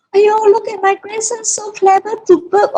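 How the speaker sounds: doting grandma. Retold or imitated. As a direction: coo over the grandson like a proud grandmother. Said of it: "my mother was standing outside there saying".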